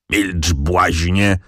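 very slow, slurred mumble; mumbled. A narrator's reading. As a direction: mumble it thickly through an injured jaw. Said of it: "wybełkotał baron".

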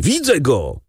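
high-pitched excited call; narrated cry from behind a door; triumphant shout. A narrator's reading. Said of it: "krzyknął głos za drzwiami".

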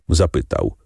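plain reading voice

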